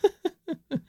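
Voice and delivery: squeaky amused giggle; high giggle. Real, not acted. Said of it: "Listeners, it's disgusting".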